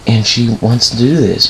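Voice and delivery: plain reading voice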